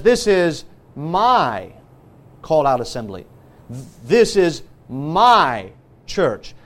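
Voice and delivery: long, swooping MY before a pause; stress on MY. Real, not acted. Preacher setting Christ's church apart, MY drawn out. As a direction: stretch out MY, then drop low after it.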